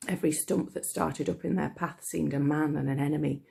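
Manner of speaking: ordinary delivery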